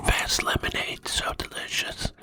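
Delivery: whispered ASMR praise of the drink; close-mic ASMR whisper. Shown as a demonstration. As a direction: murmur it like a tasting ASMR clip. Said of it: "A M S R lemonade".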